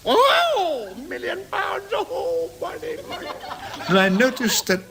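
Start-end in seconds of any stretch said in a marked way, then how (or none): 0.1-2.5 much higher, falsetto amazed; mock falsetto delight at a million pounds; greedy gasp. Acted greedy gasp at money. 2.6-3.3 whispered greed. Acted. Acted greed.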